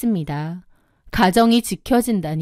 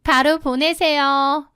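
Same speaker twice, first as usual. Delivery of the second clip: higher, slow sister-in-law order; triumphant demand; 5 semitones higher, slower than usual. Acted. Sister-in-law ordering immediate payment, smugly demanding. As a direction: draw it out high, expecting obedience.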